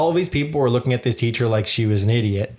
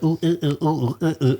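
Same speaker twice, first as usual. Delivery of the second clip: imitated effortful oohs; 4 semitones higher than usual. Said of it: "you're like".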